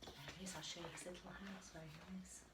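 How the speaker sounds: ordinary delivery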